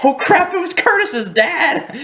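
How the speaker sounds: high, laughing dismay at the twist; laughing realization. Real, not acted. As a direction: crack up as it dawns on you.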